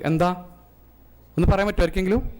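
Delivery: usual speaking voice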